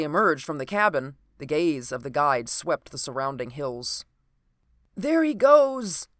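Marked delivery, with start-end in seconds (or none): 4.9-6.1 high, loud, drawn-out cry; cried out. A narrator's reading. Guide spotting the fleeing man, crying out. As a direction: call out the sighting slowly and loudly. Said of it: "he cried".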